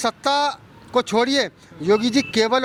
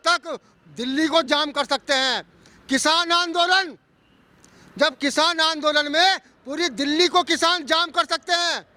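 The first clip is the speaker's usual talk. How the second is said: raised, emphatic public address; protest speech; 4 semitones higher than usual. Real, not acted. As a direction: put pointed questions to those in power, phrase by phrase. Speech of challenge to the Prime Minister.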